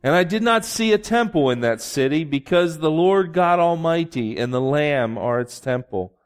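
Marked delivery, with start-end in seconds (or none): none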